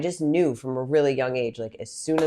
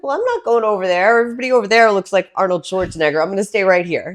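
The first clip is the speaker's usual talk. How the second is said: higher, louder, fast nervous refusal; defensive protest; 6 semitones higher, 8 dB louder, faster than usual. Retold or imitated. Retelling to the interviewer how she refused to enter the weight room, high and fast. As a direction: blurt it out, half-scared and fast. Retold young self refusing.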